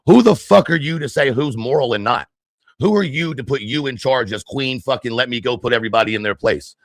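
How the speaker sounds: usual speaking voice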